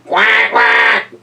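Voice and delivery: complaining duck. Acted. A duck complains about the splash, quacking. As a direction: quack grumpily. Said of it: "complained a duck".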